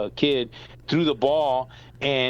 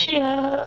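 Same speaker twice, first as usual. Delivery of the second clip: mock crying scream; 13 semitones higher than usual. Hurt kid turning round to dad, mock wailing. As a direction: imitate a hurt kid's cry. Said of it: "murderly screams".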